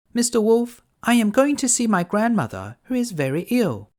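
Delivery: put-on little girl voice. Girl answering the wolf, high and innocent.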